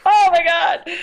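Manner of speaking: high, loud laughing "Oh my God"; laughing exclamation. Retold or imitated. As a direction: burst out with a laughing gasp of disbelief. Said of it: "so, like".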